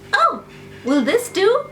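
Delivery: the cub holds out a piece of meat, high and hopeful; eager lion cub. Acted. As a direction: offer it up brightly like a small cub.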